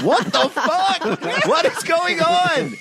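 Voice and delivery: high, drawn-out yell of disbelief; hammed-up alarm, stretched out and way up high; retold shocked outcry. Passenger to balloon pilot as the basket comes down, shocked outcry.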